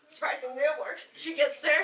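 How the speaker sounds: ordinary delivery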